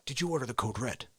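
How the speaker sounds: low, soft, quick question; quiet interrogation. Acted. Lawyer asking the colonel, low and controlled. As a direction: press him without raising the voice.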